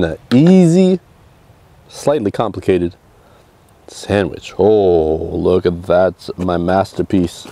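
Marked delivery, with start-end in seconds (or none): none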